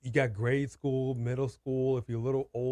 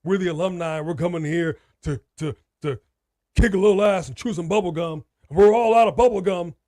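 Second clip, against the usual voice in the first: mock tough-guy boast; 3 semitones higher, 9 dB louder than usual.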